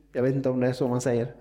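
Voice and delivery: ordinary talk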